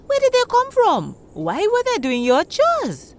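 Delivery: high, puzzled questioning; the women pry, wide-eyed and curious; curious villagers. A narrator's reading. Village women quizzing Shindo, puzzled and high.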